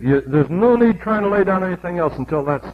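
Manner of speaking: ordinary talk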